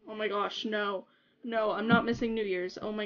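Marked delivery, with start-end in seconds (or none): none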